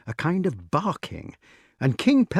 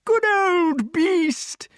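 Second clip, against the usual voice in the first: very high, stretched king's cheer; high-pitched exultant call; delighted yell; 3 dB louder, much slower than usual. A narrator's reading. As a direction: cheer the hound on, high and slow. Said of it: "yelled King Pellinore".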